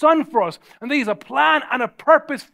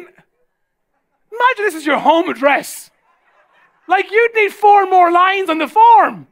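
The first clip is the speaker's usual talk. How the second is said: loud, high, drawn-out disbelief; comic exasperation; 7 semitones higher, 5 dB louder, slower than usual. Real, not acted. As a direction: stretch it out, incredulous and louder. Mock outrage at absurdly long address.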